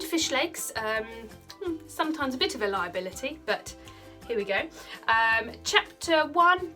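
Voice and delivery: usual speaking voice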